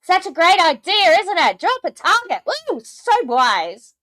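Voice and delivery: very high, loud sarcastic praise; squealed, hammed-up approval; mock gushing. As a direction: gush with exaggerated fake admiration. Mock praise of the idea just heard.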